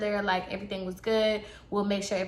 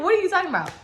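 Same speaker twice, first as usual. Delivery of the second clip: retold baffled question; 9 semitones higher, 3 dB louder, much faster than usual. Retold reply, louder take.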